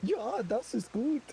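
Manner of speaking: put-on hammy German accent, slowed; mock German, drawn out; fake German. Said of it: "others are quite fluent in fake German".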